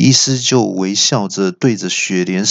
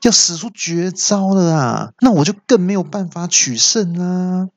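much higher, whiny pleading cry; a scared kid begging to be let off; panicked yell; 9 semitones higher than usual. Acted. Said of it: "就大叫".

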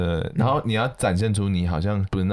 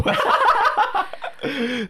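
high squealing laugh; long laugh; 4 dB louder than usual. Real, not acted. Laughing after punchline. (长笑)

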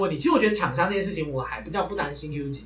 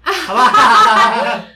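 laughing plea; 3 semitones higher, 11 dB louder, much slower than usual. Real, not acted. Begging not to name names.

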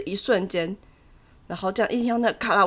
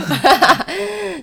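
high falling laugh; 10 semitones higher, 3 dB louder than usual. Real, not acted. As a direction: laugh at the joke. Guest laughs at episode title. (哈哈大笑)

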